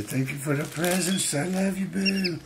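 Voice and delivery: high, soft thank-you voice; voicing the dog. Owner voicing the dog thanking Boo in a high, soft voice.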